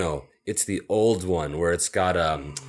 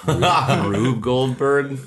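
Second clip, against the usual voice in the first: higher, louder, drawn-out disbelief; incredulous; 5 semitones higher, 6 dB louder, much slower than usual. Real, not acted. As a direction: Rube Goldberg, slowly. Incredulous question.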